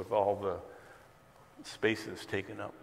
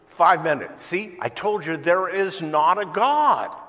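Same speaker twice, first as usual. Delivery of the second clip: higher and louder, slow triumphant gloat; gloating announcement; 6 semitones higher, 10 dB louder, slower than usual. Retold or imitated. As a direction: crow over the crowd, slowly. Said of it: "And finally he says".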